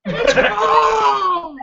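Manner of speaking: high laughing shout. Real, not acted. Fan laughing at the characters, high laughing yell. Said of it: "what is wrong with these people".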